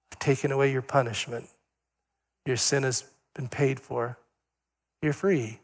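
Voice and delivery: soft, quiet, reassuring; God's quiet forgiving voice; gentle divine forgiveness. Acted. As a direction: speak it like a gentle pardon. Voicing God forgiving softly.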